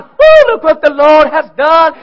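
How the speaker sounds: high, loud whoop of praise; joyful shout. Retold or imitated. Israelites celebrating in the wilderness, whooping loud with joy. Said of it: "they shouted".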